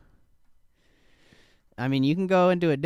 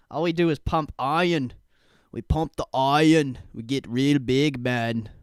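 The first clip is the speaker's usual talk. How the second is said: lightly voiced Arnold, louder and punchy; a bad stab at a bodybuilder Arnold; hammed-up gym-bro Arnold; Arnold impression; 5 dB louder than usual. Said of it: "I can't do a good Arnold voice".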